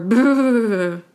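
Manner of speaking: brr-like boo because it's cold. Shown as a demonstration. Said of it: "it's cold".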